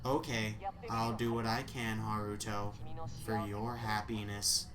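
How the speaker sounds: low, soft, even assistant voice; AI secretary voice. Acted.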